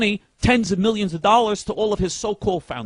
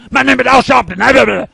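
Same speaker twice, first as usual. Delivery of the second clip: higher, louder put-on caricature voice; mock Al Sharpton voice; 6 semitones higher, 9 dB louder than usual. Radio host mocks how a rival speaks, higher and louder caricature. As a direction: ham up a mocking imitation of a public figure. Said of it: "who talks like this".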